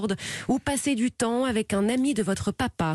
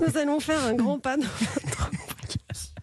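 reading on, slow and higher, through a fit of giggles; fighting a fit of giggles; 5 semitones higher, slower than usual. Real, not acted. Host reads the portrait after a giggle fit, suppressed giggles. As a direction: hold back the laugh as you read. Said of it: "J'ai un fou rire".

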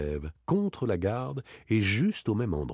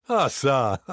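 laughing; 5 semitones higher, 6 dB louder than usual. A narrator's reading. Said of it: "Daspry se mit à rire".

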